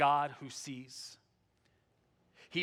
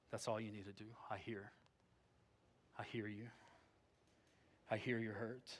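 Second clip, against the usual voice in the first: tender voice of God; 7 semitones lower, 15 dB quieter than usual. Acted. God comforts a weeping believer, softly and tenderly. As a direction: let each "hear" land gently.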